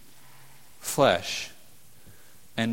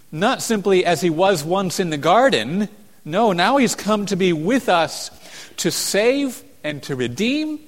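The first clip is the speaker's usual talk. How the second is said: high, loud impassioned preaching; preaching at full force; 7 semitones higher, 7 dB louder than usual. Acted. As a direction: proclaim it with fire.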